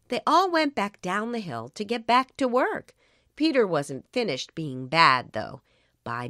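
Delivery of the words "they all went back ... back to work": usual speaking voice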